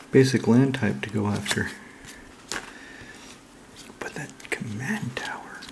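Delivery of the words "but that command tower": soft, much quieter breathy whisper; awed whisper. Real, not acted. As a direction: murmur it like a treasure. Admiring a card again.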